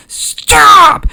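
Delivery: very high, loud scream; screamed command. Acted. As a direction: scream the order down the hall. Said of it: "And Mothma screams".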